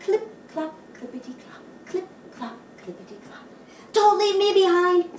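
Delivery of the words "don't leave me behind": high, loud pleading voice; pleading duck. Acted. Duck pleading with the horse, high and loud. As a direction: beg not to be left. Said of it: "says Duck".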